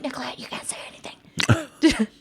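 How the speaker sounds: hushed urgent whisper, high and breathy with panic. Retold or imitated. Brothers warning sister at the door, in an urgent whisper. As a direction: whisper the warning so the cop can't hear.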